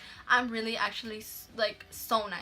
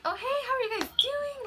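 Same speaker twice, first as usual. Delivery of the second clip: very high, sing-song greeting to customers; louder, high-pitched phony friendliness; fake customer-service cheer; 4 dB louder than usual. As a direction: put on an over-sweet, high server greeting. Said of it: "hella different attitude".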